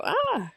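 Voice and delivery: high imitated startled "ah"; quick high yelp, imitating a scared cat; mock fright squeal. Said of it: "hair stands up and they go".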